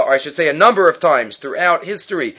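ordinary talk, nothing marked

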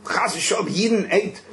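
high, slow, horrified recoil with a laugh; hammed-up shock at eating non-kosher meat; mock disgust. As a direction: play up the revulsion, half laughing.

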